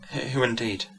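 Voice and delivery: soft murmured question; murmuring to herself. A narrator's reading. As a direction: murmur it softly, half to herself. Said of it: "murmured the lady".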